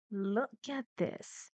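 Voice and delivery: ordinary talk, nothing marked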